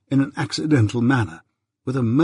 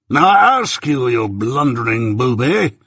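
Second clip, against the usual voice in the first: very stern; 4 dB louder, slower than usual. A narrator's reading. Jaggers sternly rebuking the blundering Mike. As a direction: come down hard on him. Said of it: "said my guardian very sternly".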